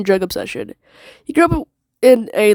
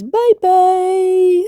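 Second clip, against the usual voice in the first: sing-song bye; 11 semitones higher than usual. Real, not acted.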